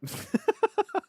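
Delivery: real, helpless high-pitched laugh; high burst of laughter. Real, not acted. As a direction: crack up, squealing high. Cracks up as timer ends.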